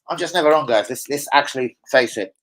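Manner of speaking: usual speaking voice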